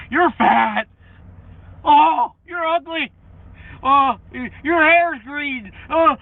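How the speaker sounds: high loud fake-crying taunts; mocking whiny trolls. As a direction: wail the insults in a mock crybaby voice. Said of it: "I just love it".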